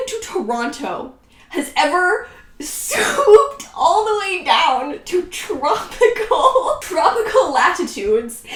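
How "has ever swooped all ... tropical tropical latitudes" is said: loud, high, slow, laughing outrage; real laugh while yelling in disbelief; incredulous laughing. Real, not acted. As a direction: laugh out the absurd flight path. Mocking her plot logic.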